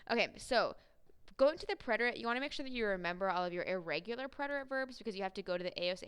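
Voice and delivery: ordinary delivery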